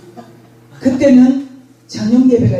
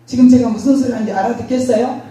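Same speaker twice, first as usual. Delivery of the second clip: normal talking voice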